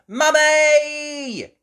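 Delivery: high, loud, long call; child's shout. Acted. Little pig calling her mother downstairs, shouting. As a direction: shout for Mum with the last vowel held. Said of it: "shouted Peppa".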